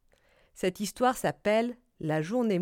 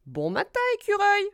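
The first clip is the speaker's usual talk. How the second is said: cheery rabbit greeting, jumping higher on the name; lapin qui salue; 11 semitones higher, 5 dB louder than usual. Acted. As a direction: call out hello as a storybook rabbit. Lapin salue son ami. (salutation lancée d'une voix de lapin)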